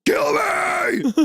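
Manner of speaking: high, drawn-out comic shriek; hammed-up high-pitched scream; mock scream. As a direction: scream it in pretend agony. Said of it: "I'd be screaming".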